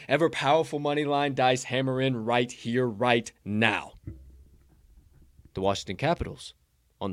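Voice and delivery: building sales-pitch read, punchy lists; hyped pick announcement. Acted. Host reading the dice pick to bettors, hyped announcer style. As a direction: sell it like a ringside announcer.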